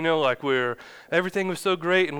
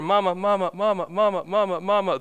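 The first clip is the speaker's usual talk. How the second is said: higher, repetitive sing-song "mama"; mimicked toddler calling; 4 semitones higher than usual. Strong-willed one-year-old calls mama endlessly, mimicked toddler whine.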